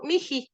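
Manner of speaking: "miji" with a j sound between the vowels; aspirated h. Shown as a demonstration. Teacher reads phonetic spelling to student, "miji" with aspirated h. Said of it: "así se pronuncia".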